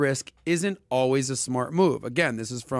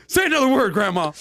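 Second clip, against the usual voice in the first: high, loud laughing threat; mock threat; 8 semitones higher, 6 dB louder than usual. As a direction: threaten grandma while laughing.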